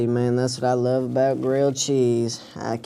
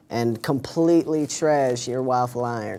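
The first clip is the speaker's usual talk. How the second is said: normal talking voice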